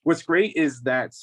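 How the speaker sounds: usual speaking voice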